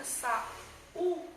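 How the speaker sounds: pause, then punch the "u" after "sa"; emphatic syllable-split "sa, u"; stressed second syllable. Shown as a demonstration. Teacher contrasts the two vowels.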